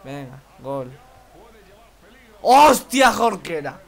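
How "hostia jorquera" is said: loud high burst of joy; excited shout. Real, not acted. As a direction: yell it out at the goal. Goal celebration in game.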